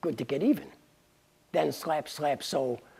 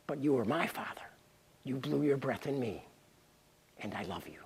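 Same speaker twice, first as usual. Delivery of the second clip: tender; 4 semitones lower, 9 dB quieter, faster than usual. Acted. Adman's tender confession to God.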